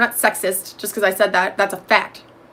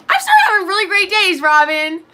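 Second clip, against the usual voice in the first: put-on excited kid; 9 semitones higher, 4 dB louder than usual. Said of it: "they'd be like".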